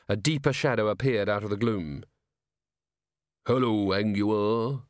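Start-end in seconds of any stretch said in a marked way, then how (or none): 3.5-4.8 slow, rolling shadowy barman; deep rolling voice. A narrator's reading. Shadow greets Angua at the bar, deep and rolling. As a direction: roll the greeting out slowly and deep. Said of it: "in a deep, rolling voice".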